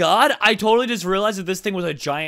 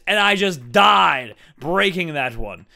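normal talking voice

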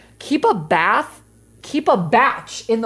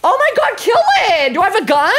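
panicked screaming; 13 semitones higher, 4 dB louder than usual. Real, not acted. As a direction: scream it in terror. Panicking at monster in game.

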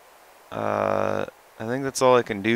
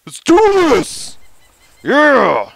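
very high, loud rallying shout; hyped battle cry; 13 semitones higher, 12 dB louder, slower than usual. Real, not acted. Player rallying his secretary on the fitness track, very high and loud. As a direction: psych yourself up, high and loud. Psyching up louder.

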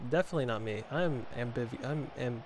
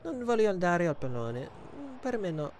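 Buffon voice; 8 semitones higher than usual. Retold or imitated. Goalkeeper refusing to go for the ball, Buffon voice. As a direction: plead off like a fragile old man. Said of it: "make him sound like he's a fragile old man".

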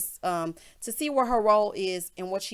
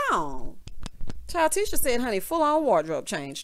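ordinary delivery

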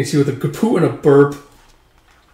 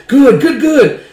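high, loud, excited praise; acted eager delight; 11 semitones higher, 6 dB louder, slower than usual. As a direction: burst out in gleeful approval. Delighted at plate of sweets.

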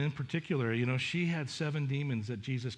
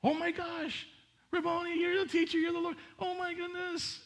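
much higher, breathless amazement at Mary's name; overjoyed recognition; 13 semitones higher than usual. Acted. Preacher acting Mary recognizing Jesus, high overjoyed cry. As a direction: cry out in sudden joyful recognition. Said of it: "he goes".

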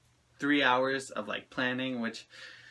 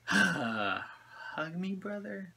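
a soft, breathy whisper on the last word; whispered quote; 6 semitones higher, 5 dB quieter, much slower than usual. Retold or imitated. Reviewer quoting the creator's catchphrase, in a soft whisper. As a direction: hush it like a secret request. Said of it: "I'll do the hug me, brother".

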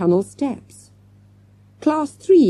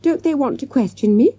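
higher-pitched affronted question; indignant; 7 semitones higher than usual. A narrator's reading. Girl ignored by passing gendarmes, indignant. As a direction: ask it high and put out. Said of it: "she added indignantly".

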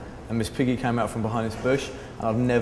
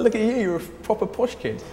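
high put-on jeering voice; mocking taunt; 10 semitones higher than usual. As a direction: sneer at the posh kid in a silly voice. Rude guy taunting again.

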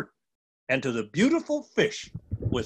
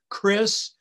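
ordinary delivery, nothing marked

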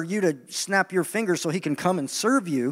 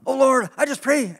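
higher, whiny pleading prayer; mock pleading prayer; 7 semitones higher than usual. Preacher mocking worshippers begging for breakthrough. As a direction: beg God in a high strained voice. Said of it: "sometimes we are".

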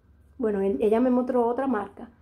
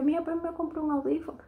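quoting herself; 6 semitones higher, 3 dB quieter, faster than usual. Retold or imitated.

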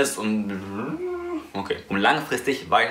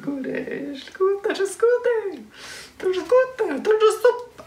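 very high squeaky cartoon voice.

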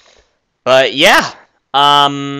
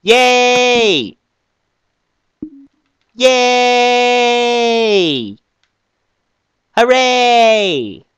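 overjoyed cheering; 8 semitones higher, 3 dB louder, slower than usual. Acted. Celebrating his success.

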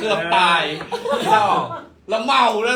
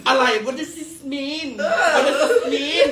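put-on confused drunk friend; 6 semitones higher, much slower than usual. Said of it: "อีตูนมึงเลิกดิ".

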